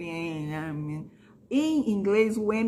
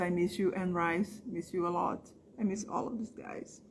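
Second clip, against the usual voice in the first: tender farewell; 3 semitones lower, 8 dB quieter than usual. Real, not acted.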